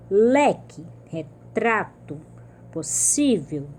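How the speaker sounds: word by word, leaning on each next-to-last syllable: Leque, retrato, possível; penúltima sílaba forte. Shown as a demonstration. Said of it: "quando a penúltima sílaba é tônica". (Leque, retrato, possível, marcando a penúltima sílaba)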